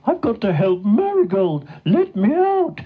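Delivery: high, frantic cow calling out; mooing at top voice. A narrator's reading. Shut-in cow desperate to save her friend, frantic. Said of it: "still mooing at the top of her voice".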